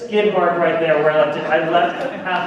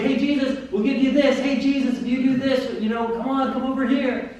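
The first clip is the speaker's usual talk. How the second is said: higher-pitched coaxing for attention; eager crowd calling; 7 semitones higher than usual. Acted. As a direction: call out to win him over. Crowd vying for Jesus' attention.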